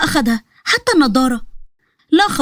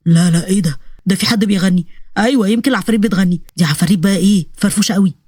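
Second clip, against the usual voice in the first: low-voiced jittery soldier, puzzled and scared; lower, spooked young soldier talking to himself; low voice of a frightened recruit; nervous soldier; 6 semitones lower than usual.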